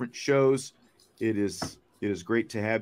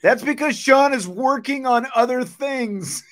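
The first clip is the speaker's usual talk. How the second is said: laughing as he answers, pitched up; 12 semitones higher, 5 dB louder than usual. Real, not acted. Host laughs off a viewer's jab, laughing. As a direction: chuckle through the defence.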